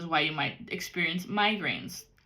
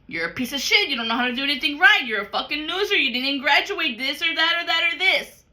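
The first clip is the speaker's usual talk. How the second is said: harsh inner critic; 7 semitones higher, 7 dB louder than usual. Retold or imitated. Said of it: "treat yourself like they treated you".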